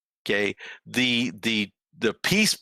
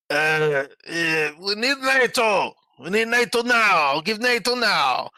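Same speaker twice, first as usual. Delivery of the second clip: high, insistent imitation of a politician; hammed-up plea, pitched up and building to a mock shout; mock demanding leader; 7 semitones higher, 3 dB louder, slower than usual. Acts out Zelensky's answer, demanding NATO.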